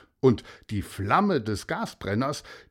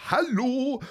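high excited hello; the commissioner calls up to the balloon, high and eager; 14 semitones higher than usual. Acted. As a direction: wave and call up happily. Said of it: "rief auch".

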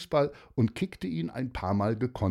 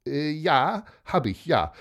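slightly uncertain, higher-pitched admission; unsure; 6 semitones higher, 4 dB louder than usual. A narrator's reading. Martin admitting the swap to his father, a bit unsure. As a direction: own up hesitantly. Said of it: "sagte Martin etwas unsicher".

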